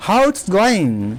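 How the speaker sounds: very slow, drawn-out model greeting; slow model phrase. Shown as a demonstration. Teacher slowly modelling a casual greeting for students. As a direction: stretch every word for the class. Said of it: "इसको short में बोलेंगे".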